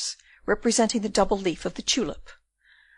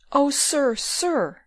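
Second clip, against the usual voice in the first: higher, pleading appeal; imploring; 6 semitones higher, much slower than usual. A narrator's reading. Rosa to the departing burgomaster, imploring. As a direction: implore him to stay and listen. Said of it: "said Rosa imploringly".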